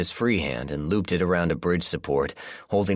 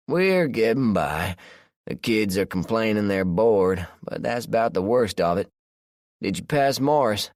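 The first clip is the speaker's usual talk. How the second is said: relaxed country man, unbothered; Josh, southern man; 5 semitones higher, 3 dB louder than usual. A narrator's reading. Josh voiced by narrator.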